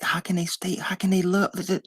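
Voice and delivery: mock disbelief that trails into stuttering; bewildered onlooker. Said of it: "'cause you like".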